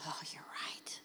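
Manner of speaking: very quiet, low whisper; barely audible, sheepish whisper; hushed, chastened admission. Retold or imitated. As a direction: whisper it, conceding the point. Acting out a chastened reply.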